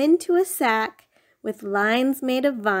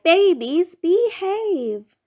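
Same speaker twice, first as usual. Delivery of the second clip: high raised call to the babies; 8 semitones higher, 3 dB louder, slower than usual. Acted. Parent calling to babies in the cave, high scolding call. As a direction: scold them from afar, pitched high. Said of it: "You cried".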